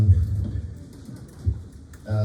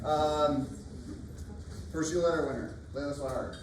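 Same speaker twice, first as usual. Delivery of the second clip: projected, higher announcing voice; teacher voice; 8 semitones higher, 10 dB quieter than usual. Real, not acted. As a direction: call the names out across the room. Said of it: "Can I use my teacher voice?".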